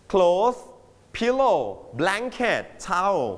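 name each item slowly for learners; slow listing. Shown as a demonstration. Teacher slowly naming packed items for the class.

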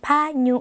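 "พายุ" as pha-nyu, short last vowel; nasal Lao ny. Shown as a demonstration. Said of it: "ออกเสียงขึ้นจมูกเป็น".

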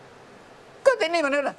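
put-on high, slow whiny kid voice; squabbling child. Father imitates his son arguing, in a high whiny kid voice. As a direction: mimic a bickering child, high and drawn out. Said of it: "ไอ้นี่ก็จะเถียงกันอีก".

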